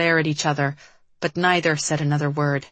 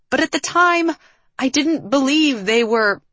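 high-pitched, defensive protest; yelling back; 9 semitones higher, 4 dB louder than usual. A narrator's reading. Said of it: "Liv yelled back".

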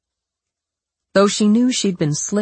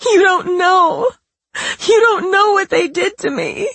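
fake sobbing; 5 dB louder than usual. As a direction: sob the words out, staged. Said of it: "She added broken sobs".

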